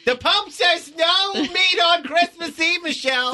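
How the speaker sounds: high, slow, shouted mock scolding; imitating her mother. Co-host scolding Michelle as her mother, high mock shout. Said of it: "You sound just like my mother".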